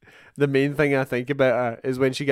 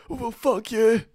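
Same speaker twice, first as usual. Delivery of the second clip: mock raging; 8 semitones higher than usual. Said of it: "He gets pure raging".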